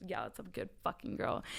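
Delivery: low, hushed bedroom growl of a dominant man; imitating his low whisper. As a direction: breathe it low like he did in her ear. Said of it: "and goes".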